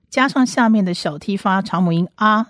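ordinary talk

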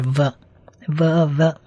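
"v" as a short vuh syllable; isolated sound demo. Shown as a demonstration. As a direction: repeat "v" as vuh, slowly with pauses. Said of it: "vaf发v的音".

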